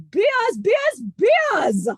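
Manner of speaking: loud, high party chant of beers; Cosmo cheering. Acted. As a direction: cheer for beers like a rowdy drinker. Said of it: "This is Cosmo".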